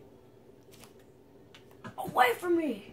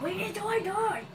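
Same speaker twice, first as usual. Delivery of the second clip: higher, called out at the dragon; exclaimed retort; 8 semitones higher than usual. Real, not acted. Gamer calling out at the Ender Dragon, higher exclaim. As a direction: throw it at the dragon. Muttered then repeated loudly.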